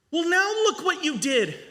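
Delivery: put-on boy blaming the stranger after the sleeves fall off; accusing boy voice. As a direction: whine the blame like a child.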